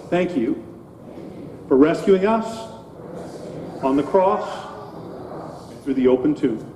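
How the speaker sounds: short prayer phrases with long pauses for repetition. Real, not acted. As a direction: pray phrase by phrase, leaving room to echo. Leads children in repeated prayer.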